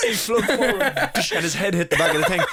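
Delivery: high, laughter-filled retelling; laughing while talking. Real, not acted. Telling co-host how his head hit, laughing hard. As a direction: fight through the giggles to tell it. Cracking up mid-story.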